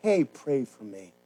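low, quiet and slow; soft slow plea. Shown as a demonstration. Pastor quietly asking the congregation for prayer. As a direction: drop low and ask gently.

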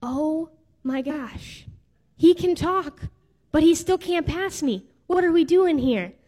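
put-on exasperated breathless kid. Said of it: "who I'm sure was going".

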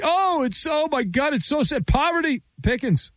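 high mock sobbing pity over poverty. Host mocking a player who cries poverty, high mock pity.

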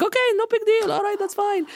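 nervous dad. Retold or imitated. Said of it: "He's like".

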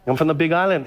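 ordinary delivery

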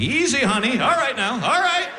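high, climbing nervous protest; mock alarm. Boyfriend squeezed by girlfriend, high mock-panicked voice. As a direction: act increasingly squeezed, pitch rising each phrase.

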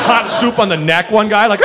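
ordinary talk, nothing marked

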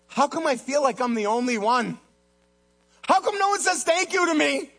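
high-pitched whining protest, acted; aggrieved complaint. Church volunteer feeling like the only one helping, aggrieved complaint. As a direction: complain as the one nobody thanks.

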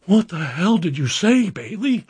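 angry supervisor. Retold or imitated. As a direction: drag out an exasperated boss's question. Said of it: "my supervisor comes right back behind me".

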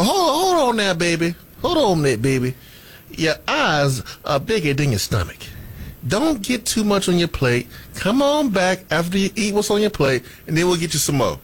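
put-on high, sweet old grandma voice; doting grandmother scolding gently. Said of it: "You like my grandma voice?".

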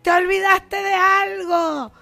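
mock yell out the window, a bit slowed; retold shout. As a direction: call out to the young man as you retell it. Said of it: "y le grité".

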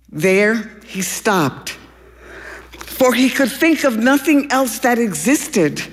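plain reading voice

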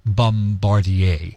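Bombardier drawn out slowly the French way, bom-bar-dee-AY; dainty French pronunciation. Shown as a demonstration. Showing off the fancy Canadian company name, dainty French pronunciation. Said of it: "say it with your little pinky out".

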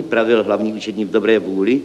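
usual speaking voice

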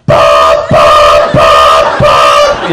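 deep loud alarm-clock beeps; be the alarm going off, low and loud; loud low imitated alarm. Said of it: "and she goes".

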